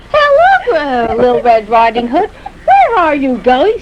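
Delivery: big bad wolf. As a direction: play the sly wolf sweet-talking her. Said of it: "And the wolf goes".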